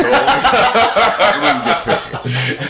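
high, slow, laughing through the words; real laughing. Real, not acted. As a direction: crack up at your own joke. Joking and breaking into laughter.

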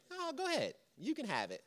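sweetly high "go ahead", then dropping quiet; hammed-up polite driver letting someone in; mock gracious. Preacher mimicking a gracious driver, high mock sweetness. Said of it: "Do you just say".

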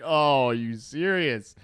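unbelieving, stretch it out; retold groan at the friend; drawn-out disbelief. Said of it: "I'm like".

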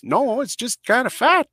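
ordinary delivery

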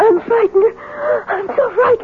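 shaking with fright, clinging to him; frightened. Acted. Hetty to Fred once he arrives, trembling with fear. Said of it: "why are you shaking like that?".

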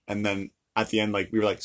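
usual speaking voice

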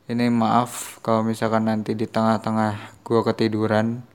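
ordinary delivery

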